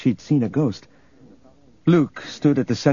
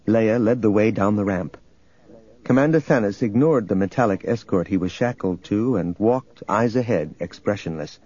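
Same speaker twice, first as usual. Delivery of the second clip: ordinary delivery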